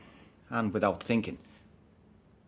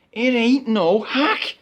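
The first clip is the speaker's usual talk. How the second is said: defiant; 4 semitones higher, 7 dB louder, much slower than usual. Acted. Defiant retort.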